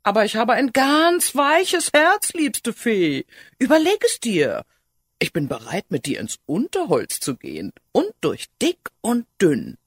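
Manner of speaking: high, loud hedgehog plea; cheeky hedgehog, eagerly wooing. Acted. Hedgehog courts the reluctant fairy, eager and insistent. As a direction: plead your case as an eager little suitor. Hedgehog pleads his suit.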